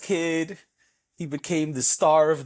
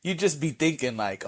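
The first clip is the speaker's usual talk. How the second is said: usual speaking voice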